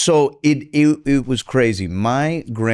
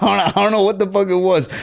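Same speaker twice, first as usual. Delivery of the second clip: laughing; 9 semitones higher, faster than usual. Real, not acted. Laughing about grandmother's biting bird.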